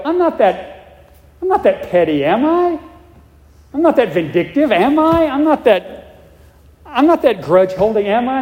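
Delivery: high rising, indignant am I; defensive disbelief. Retold or imitated. Pastor imitates a person denying their faults, rising and indignant. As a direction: protest like someone hearing an unwelcome truth. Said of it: "you'll go".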